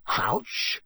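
startled, rising question; alarmed. A narrator's reading. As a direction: query the name in sudden mild alarm. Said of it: "He looked suddenly alarmed".

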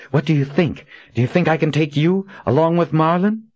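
cold challenge to the villain; heroine voice. A narrator's reading. Kahlan threatens Jagang.

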